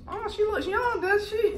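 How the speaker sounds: high, teasing mom impression. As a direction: retell mom's surprised comment. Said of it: "my mom was like".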